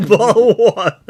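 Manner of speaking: high loud mock Cockney; British accent. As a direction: shout it like a London bobby. Trading British impressions.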